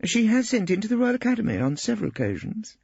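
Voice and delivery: high, eager wife; anxious Hilda. A narrator's reading. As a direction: seek his approval. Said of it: "waiting anxiously".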